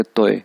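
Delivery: तोए falling in one syllable; fourth tone. Shown as a demonstration. Teacher telling learners how to read तोए, falling tone. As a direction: let तोए fall cleanly. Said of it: "इसको पढ़ेंगे".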